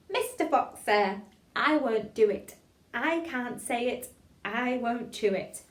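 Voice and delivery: slow, loud, every word articulated; tongue-twister recitation. Shown as a demonstration. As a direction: chant it deliberately for a class to follow.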